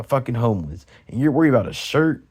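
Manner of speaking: ordinary delivery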